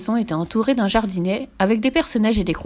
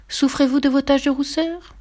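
higher, sing-out advertising question; placard read aloud; 7 semitones higher than usual. A narrator's reading. Narrator reads the pharmacy placard, higher announcing question. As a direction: announce it like a shop sign.

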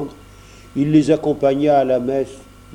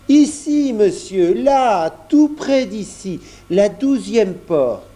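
much higher, louder, slowing down as he points; insistent grocer; 7 semitones higher, 3 dB louder, slower than usual. A narrator's reading. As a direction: grocer patiently insisting, voice raised. (épicier insistant, voix haute et plus forte)

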